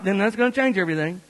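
ordinary delivery

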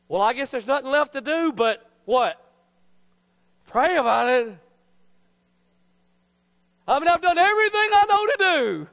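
high, loud, hammed-up desperation; mock exasperation. Believer who has tried everything, loudly exasperated. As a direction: wail it as a mock last resort. Acting out people's last-resort prayer.

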